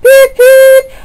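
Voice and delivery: high loud toy-train beep; engine horn beeps. Acted. Thomas beeping happily before setting off. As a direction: honk it out like a horn.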